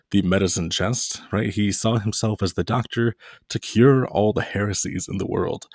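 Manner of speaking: usual speaking voice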